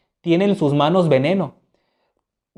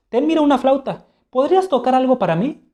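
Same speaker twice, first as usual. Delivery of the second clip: teasing offer; 8 semitones higher than usual. Acted. Hamlet taunting friend.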